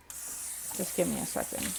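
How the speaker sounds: whispering to herself; barely voiced, slow muttered aside; whispered aside. Real, not acted. Aside while trying to recall.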